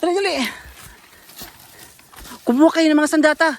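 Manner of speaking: loud battle commands; rally them to grab weapons; urgent shouting. Real, not acted. (malakas na utos)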